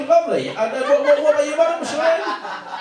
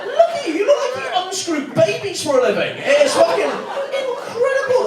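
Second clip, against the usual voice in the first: high, laughing disbelief at the size of him; amazed excited rave; 8 semitones higher than usual. Real, not acted. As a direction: crack up in delighted shock. Mock amazed at huge man.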